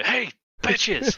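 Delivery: high-pitched mock catcall; hammed-up, high attention-grabbing shout; brazen taunt. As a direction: call out brazenly to get their attention. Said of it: "lifted your top up and go".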